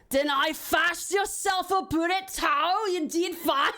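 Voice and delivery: hammed-up Scottish, pitched high and slow; exaggerated, badly faked Scottish accent. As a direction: deliberately have a go at terrible Scottish. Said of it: "I cannot do a Scottish accent".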